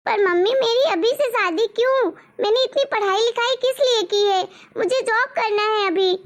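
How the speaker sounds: put-on a grown girl pushing back at her mother; indignant daughter; young daughter protesting.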